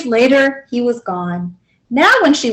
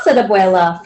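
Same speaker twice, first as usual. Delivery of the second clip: ordinary delivery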